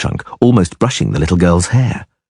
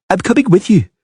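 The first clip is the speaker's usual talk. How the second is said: put-on high child's voice; grudging surrender; much higher girl; girl giving in; 11 semitones higher than usual. A narrator's reading. Girl gives in.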